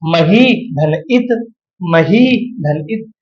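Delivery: slow, syllable by syllable; deliberate teacher's breakdown; slow dictation. Shown as a demonstration. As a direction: spell out each part slowly for the class. Teacher slowly splitting a sandhi.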